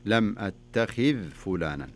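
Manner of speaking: slow "أتخذ", ذ held still before "فلانا"; dhal with sukun. Shown as a demonstration. Teacher demonstrating the correction, slow "أتخذ" with silent-vowel ذ. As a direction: stop "أتخذ" on an unvowelled ذ. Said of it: "سكني الذال".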